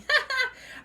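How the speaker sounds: loud, high burst of laughter; real, helpless high cackle; laughing. Real, not acted. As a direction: burst out laughing against your will. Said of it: "I should not be laughing".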